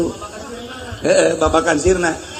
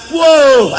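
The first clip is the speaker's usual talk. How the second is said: mock surprise; 7 semitones higher, 7 dB louder than usual. Preacher exclaims.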